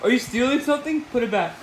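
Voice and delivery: ordinary delivery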